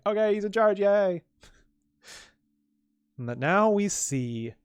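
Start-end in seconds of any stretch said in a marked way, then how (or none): 0.0-1.2 mock cheer. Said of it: "They're like".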